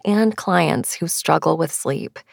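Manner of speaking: normal talking voice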